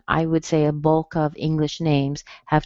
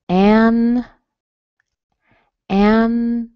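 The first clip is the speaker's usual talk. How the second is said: high, drawn-out "An", then separate juh, luh; syllable by syllable; 5 semitones higher, 4 dB louder, slower than usual. Shown as a demonstration. Teacher showing weak vowels, "Angela" split up. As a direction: break up "Angela", strong AN first. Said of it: "Strong in the beginning".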